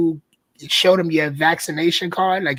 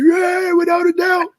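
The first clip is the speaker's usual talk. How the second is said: much higher, slow, loud hype shout; crowd shouts back; 4 dB louder, slower than usual. Pretend. A Brooklyn crowd answering the call, slow, high hype shout. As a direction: belt it back like a fired-up fan. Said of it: "Somebody gonna be like".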